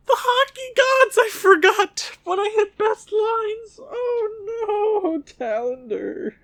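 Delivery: anguished wail. Real, not acted. Anguished wail after mistake.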